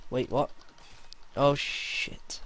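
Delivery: usual speaking voice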